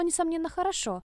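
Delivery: plain reading voice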